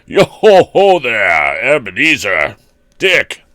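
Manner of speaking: slow, loud, jolly calling-out; jovial fat man. A narrator's reading. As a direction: call out like a rich, jovial old merchant. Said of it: "called out in a comfy, oily, rich, fat, jovial voice".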